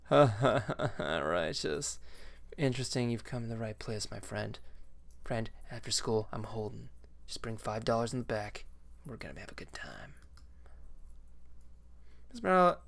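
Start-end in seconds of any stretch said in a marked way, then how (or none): 0.1-10.1 lightly voiced shady stoner teacher. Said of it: "It's my best stoner voice".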